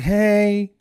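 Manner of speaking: high, loud imitated hello; called-out greeting. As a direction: call it out like a greeting across cars. Acted greeting from the young lady.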